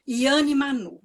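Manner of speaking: ordinary talk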